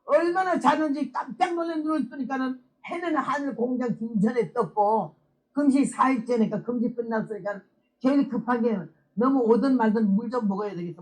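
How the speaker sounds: normal talking voice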